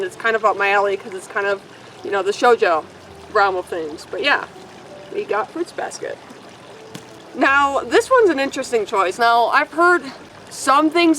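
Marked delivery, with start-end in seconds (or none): 5.1-6.2 whisper. Real, not acted. Whispered title aside.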